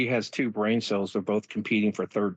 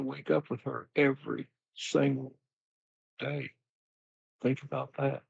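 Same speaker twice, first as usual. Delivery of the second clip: lightly voiced folksy Bill, a bit higher, sighing about his wife; Bill Clinton impression; 4 semitones higher than usual. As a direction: play Bill sharing his pain about Hillary with the crowd. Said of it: "Bill, will you tell her".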